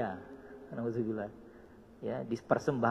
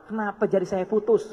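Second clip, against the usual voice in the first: higher, louder angry demand; angry king; 7 semitones higher, 6 dB louder than usual. Acted. King blaming his servant for his lost finger, angry. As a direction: snap the question at the servant. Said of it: "sambil marah, ngedumel".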